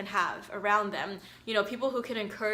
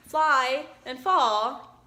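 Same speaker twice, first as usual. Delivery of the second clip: acted out; 5 dB louder, much slower than usual. Shown as a demonstration. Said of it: "we'll reenact the book".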